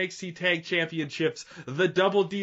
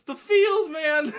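"the feels" shot much higher and louder, almost a shout; overwhelmed gush; 10 semitones higher, 4 dB louder, slower than usual. Real, not acted. Podcast host gushing about a sacrifice, much higher and louder. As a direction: cry out "the feels" as if it hits you. Gushing over emotional wrestling moment.

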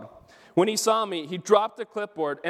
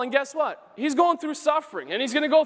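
excited; 7 semitones higher than usual. Real, not acted. Preacher building excitement.

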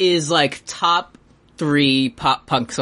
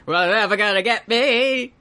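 higher, show-tune theatrical delivery; hammy stage actor; 4 semitones higher than usual. Retold or imitated. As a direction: belt it like a Broadway ham. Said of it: "like a show tunes, like, theatrical voice".

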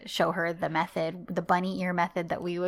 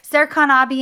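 calling out; 6 semitones higher, 7 dB louder than usual. Retold or imitated. Girl calling out to him.

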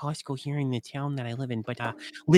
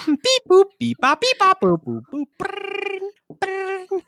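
high beeping then rolled ring; phone beeps and ringing; 4 dB louder, slower than usual. Acted. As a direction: mouth sounds of a phone dialing. Imitating dial tone and ring.

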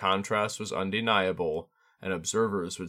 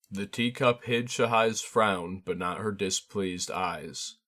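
ordinary delivery

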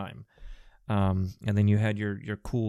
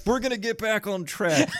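higher, mocking the cohost's segue; 10 semitones higher than usual. Teasing the cohost's segue, higher mocking voice. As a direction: parrot the awkward segue. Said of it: "then be like".

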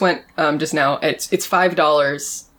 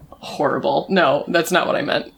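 normal talking voice

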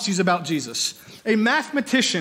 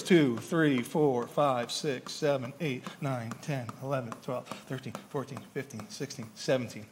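counting zeros softly, much lower, fading away; quiet counting; 10 semitones lower, 11 dB quieter than usual. Shown as a demonstration. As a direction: murmur the numbers to yourself, dropping lower and softer.

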